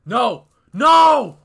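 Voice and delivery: high, loud cry of dismay; frustrated shout. Real, not acted. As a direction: yell it out as the play falls apart. Game blunder outcry.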